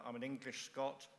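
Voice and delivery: normal talking voice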